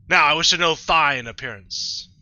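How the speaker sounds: loud, commanding deity; Arceus, godlike narrator. Acted. Player voicing Arceus asking about appearance, loud and imperious. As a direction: pronounce it like a god's decree. Reads the game's Arceus dialogue aloud.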